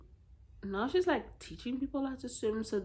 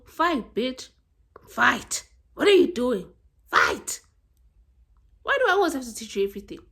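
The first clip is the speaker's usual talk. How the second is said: loud, punchy commands; mock exasperated yelling; 4 semitones higher, 9 dB louder than usual. Urging a passive person to fight, loud exasperated scolding. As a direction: yell it like a fed-up coach.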